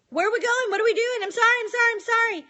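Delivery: high, panicky mock-child voice; high-pitched, repetitive pleading. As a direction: imitate a kid begging to get out of trouble. Voices a panicking child being marched off.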